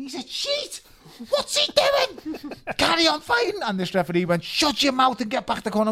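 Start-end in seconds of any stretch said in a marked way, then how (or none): 0.0-3.6 high, loud screamed protest from the ring; retold screaming. Boxer screaming at the referee, outraged and loud. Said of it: "And I was screaming". 4.4-5.9 stern referee. Retold or imitated. Referee voiced in story.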